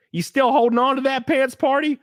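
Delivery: high, whiny mocking critic; high mock of online critics. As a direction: needle them in a high voice.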